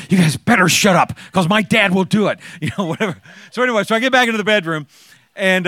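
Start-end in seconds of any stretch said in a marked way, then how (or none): 0.1-2.4 retold whisper-shout, louder; whisper yelling. Teen daughter warning her friends, mock whisper-yell. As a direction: hiss it loud like a scared teen. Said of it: "whisper yelling to her friends".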